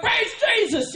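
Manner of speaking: high-pitched shout of praise; high ecstatic praise. Retold or imitated. Congregation swept up in the dance, ecstatic shout. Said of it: "we're like".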